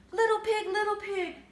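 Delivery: high, loud wolf calling at the door. Acted. Wolf at the pig's door, calling loud and high. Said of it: "said the wolf".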